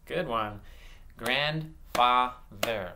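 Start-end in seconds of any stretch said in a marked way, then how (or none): 1.2-2.9 "Grandfather" broken into separate beats; "Grandfather" slowly segmented for blending; slow syllables. Shown as a demonstration. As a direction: clap out "Grandfather" slowly, pausing between syllables. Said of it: "I'm gonna say them slow and clap".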